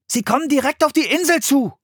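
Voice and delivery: put-on high, urgent child's voice; high-pitched, excited alarm; alarmed warning. A narrator's reading. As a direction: warn her as danger closes in. Boy warns of approaching Vikings.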